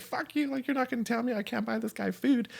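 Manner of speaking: retold high-pitched protest, not shouted; squeaky-high acted outrage at normal volume; riled up. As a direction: act out indignant defiance, voice way up high. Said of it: "I got riled".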